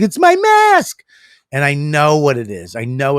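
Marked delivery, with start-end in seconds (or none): 0.0-1.0 put-on high, slow whiny child; kid voice. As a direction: squeak it like a little kid. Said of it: "He's like".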